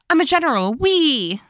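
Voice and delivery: high, giddy mock boast ending in a squeal; gleeful new general. Narrator mocks the newly promoted second in command, gleeful.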